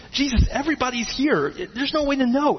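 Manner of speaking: high, disbelieving question; baffled disciples asking; incredulous disciples. Acted. Disciples answering Jesus.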